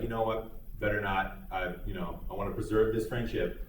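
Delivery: softly backing off, a quiet inner voice; talking yourself down. Retold or imitated. Calming inner voice backs off.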